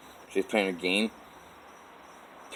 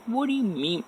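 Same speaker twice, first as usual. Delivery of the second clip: much higher, baffled what do you mean; baffled question; 13 semitones higher, 3 dB quieter than usual. Retold or imitated. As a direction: ask it in high disbelief. His confused reaction, retold.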